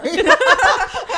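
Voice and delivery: shrieking burst of laughter; loud high laugh. Real, not acted.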